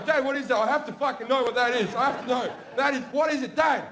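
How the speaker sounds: high, insistent clamouring; excited pestering kids. Retold or imitated. Two little sons begging Dad to say what it is, excited and insistent.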